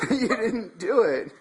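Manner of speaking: slow, laughing tease. Real, not acted. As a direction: giggle the words out. Laughing at friend failing challenge.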